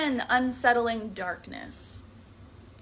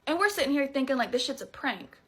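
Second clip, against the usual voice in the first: ordinary talk, nothing marked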